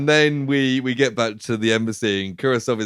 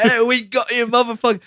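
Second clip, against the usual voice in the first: high-pitched mock taunt; jeering; 10 semitones higher than usual. Onlookers taunting the arrested man, jeering. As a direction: crow at him like a gloating crowd. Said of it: "like, jeering him".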